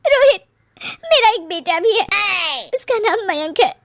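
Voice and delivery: high tearful plea, slowing as she admits it; crying. Acted. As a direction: confess the secret son while weeping. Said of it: "वो रोने लगती है". (रोते हुए धीमा कबूलनामा)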